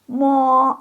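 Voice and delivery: mo held high and level. Shown as a demonstration. Teacher modeling first-tone mo for class. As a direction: sing mo on one high pitch.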